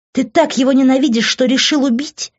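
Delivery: high, loud, outraged question; angry accusation. A narrator's reading. Daughter accuses her father, acted quarrel. (гневно, с обвинением)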